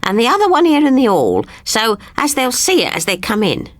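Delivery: tidy things away briskly; lightly voiced queen. Queen voice continues.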